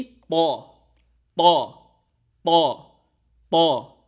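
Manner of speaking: پو said thick and heavy, with a strong burst of breath; thick heavy sound. Shown as a demonstration. Teacher showing students the thick ط sound, punched loud. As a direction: push out a full, heavy پو. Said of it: "موٹی آواز ہوگی".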